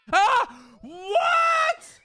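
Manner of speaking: sudden high shriek of surprise; startled shriek. Real, not acted. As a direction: yelp then a shrieked What, startled.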